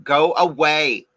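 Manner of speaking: slow, drawn-out shooing. Retold or imitated. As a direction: drag it out slowly, like shooing off a visitor.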